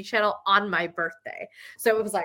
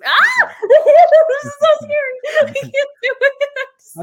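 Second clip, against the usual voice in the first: high, loud half-laughing scream of fright; laughing terrified shriek; 13 dB louder, slower than usual. Real, not acted. Talking about Old Navy's faceless mannequins to friends, a laughing scared shriek. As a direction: shriek and laugh like the mannequins are coming for you.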